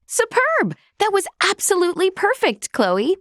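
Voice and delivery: high, fast burst of delighted praise; thrilled teacher, pitch jumping up. Acted. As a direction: gush over a star pupil. Praising a pupil's flawless work.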